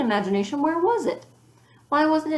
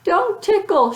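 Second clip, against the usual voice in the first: much higher, louder shriek; 12 semitones higher, 8 dB louder, slower than usual. A narrator's reading. Ramona fleeing her sister's tickling, shriek. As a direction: shriek it while running away. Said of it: "shrieked Ramona".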